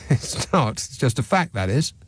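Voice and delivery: usual speaking voice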